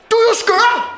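retold shout. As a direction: shriek it like an outraged coworker. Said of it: "og så råbte han".

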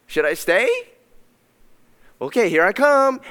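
a hint of a flattered, hesitant God; lightly voiced God. Preacher lightly voicing God's flattered reply. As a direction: play God touched and unsure, lightly. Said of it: "God's in heaven and he's like".

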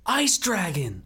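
high excited cry, imitating a trailer; excited shout. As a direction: call it out like a hyped thumbnail. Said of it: "and it goes".

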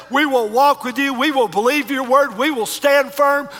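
high, loud preaching prayer; fervent prayer. Acted. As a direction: cry out to God for the church. Leads congregation in prayer.

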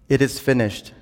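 ordinary delivery

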